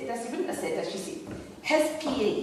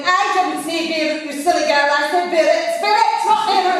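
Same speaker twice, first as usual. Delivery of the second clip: put-on higher and louder, scolding the silly girl; indignant loud correction; 9 semitones higher, 10 dB louder than usual. Client scolding her pronunciation of Beirut.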